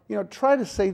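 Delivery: ordinary delivery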